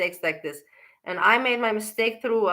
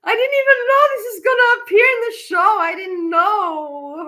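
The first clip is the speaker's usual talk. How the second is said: panicked wail; 12 semitones higher, 6 dB louder than usual. Real, not acted. Panicked that clip shows her.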